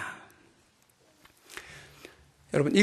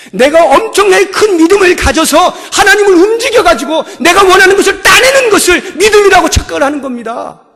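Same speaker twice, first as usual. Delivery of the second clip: fervent preaching; 10 semitones higher, 11 dB louder than usual. Acted. Preaching at full force.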